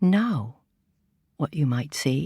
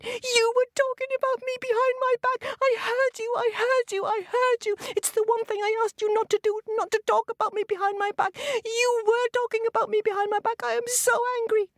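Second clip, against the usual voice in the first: much higher, fast, furious accusing voice; angry hyena. Acted. Hyena shrieks at Lion for gossiping behind her back, high and furious. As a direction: scold him in a high squeal.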